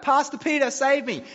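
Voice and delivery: "Pastor Peter, save me!" mock-yelled high and loud; hammed-up desperate plea from the back row; calling out for help. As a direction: cry out like someone being hauled off by police. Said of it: "They even called out".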